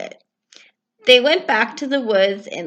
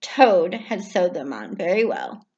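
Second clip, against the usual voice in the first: usual speaking voice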